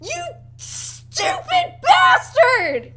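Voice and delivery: high, loud, slow furious scream of pain; pained yell. Acted. The villain, struck in the eye, screaming in pain and fury. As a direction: howl it out like a man hit in the eye. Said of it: "you hear him go".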